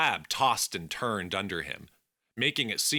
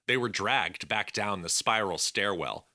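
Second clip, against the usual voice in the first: plain reading voice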